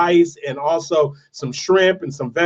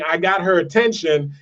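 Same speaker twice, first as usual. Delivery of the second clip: ordinary talk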